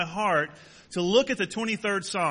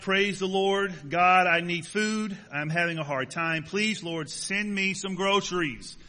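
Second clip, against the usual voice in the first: usual speaking voice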